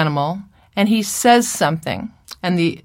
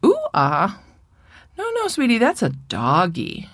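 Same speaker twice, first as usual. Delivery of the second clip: correcting mother; 4 semitones higher than usual. Acted. Mother corrects her toddler's wrong word, questioning then high motherly correction. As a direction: question it, then gently correct the little one. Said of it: "his mother's probably gonna say".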